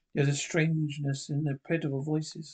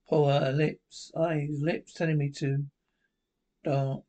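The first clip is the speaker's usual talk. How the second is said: ordinary talk, nothing marked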